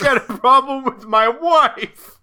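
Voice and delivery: loud, hammed-up New York accent; a brash New Yorker. Friend joins the bit with a loud New York accent.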